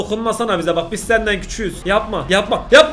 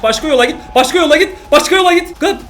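loud, high urgent shout; yelling at screen; 7 semitones higher, 5 dB louder than usual. Real, not acted. Viewer yelling at a video character to go another way, loud and high.

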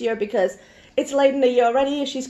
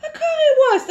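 much higher, insistent mock repeat; incredulous insistence; 14 semitones higher, 3 dB louder than usual. Retelling her order to a confused vendor, high-pitched insistence. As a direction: repeat it high, as if it were obvious.

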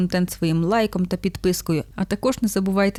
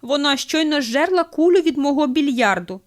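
higher-pitched acted outrage; indignant bartender; 7 semitones higher, 4 dB louder than usual. A narrator's reading. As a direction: complain in mild mock indignation. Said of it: "обурюється бармен".

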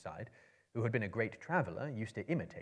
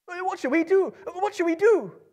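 much higher, louder panicked hobbit; frightened cry; 15 dB louder than usual. A narrator's reading. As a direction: cry out in terror. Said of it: "he cried".

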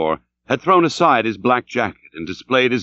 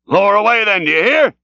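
high, projected captain's shout; shouted order; 9 semitones higher, 4 dB louder than usual. A narrator's reading. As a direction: shout it across a deck. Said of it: "shouting across the deck to all the men".

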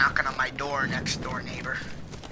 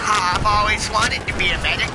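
sarcastic; 6 semitones higher, 6 dB louder than usual. Acted. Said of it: "Not really".

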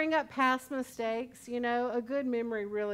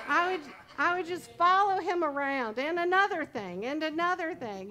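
higher, louder nagging "and another thing"; nagging follow-up; 6 semitones higher, 5 dB louder than usual. Retold or imitated. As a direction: keep nagging, chasing him round. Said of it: "call me Miss Pac-Man".

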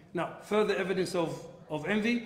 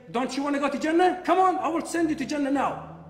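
taunt; 9 semitones higher, 6 dB louder than usual. Retold or imitated. Enemy taunting the Muslims.

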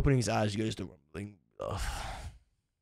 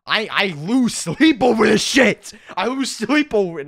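exasperated; 8 semitones higher, 12 dB louder, slower than usual. Real, not acted. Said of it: "you don't know what happens when I get off".

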